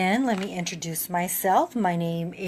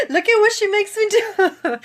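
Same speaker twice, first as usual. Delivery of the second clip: high and louder, half laughing through tears; tearful, laughing at herself; crying; 12 semitones higher, 6 dB louder than usual. Real, not acted. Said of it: "I would be on here crying".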